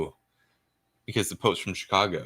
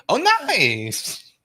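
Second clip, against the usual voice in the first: mock reaction; 13 semitones higher, 6 dB louder than usual. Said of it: "He goes".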